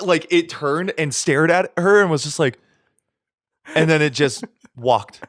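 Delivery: normal talking voice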